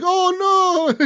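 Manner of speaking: high-pitched, drawn-out mock wail; mock distressed wail. Cop retelling a smuggler's dismay as his car gets searched, mock wail. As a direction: imitate a panicked man crying out in falsetto.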